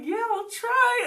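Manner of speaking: high, slow, stretched-out yes; reluctant agreement. Retold or imitated. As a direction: agree while clearly doubting yourself. Retold reluctant yes to judges.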